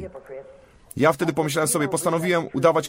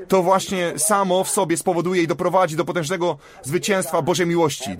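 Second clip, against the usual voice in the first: normal talking voice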